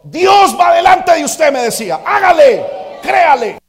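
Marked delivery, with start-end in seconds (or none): 0.0-1.5 slow, high and loud, stressing Dios; insistent father. Retold or imitated. Father insisting to his worried son, slow loud emphasis. 2.0-3.6 high, loud push to act; shouted urging. Retold or imitated. Father shouting at his son to go ahead.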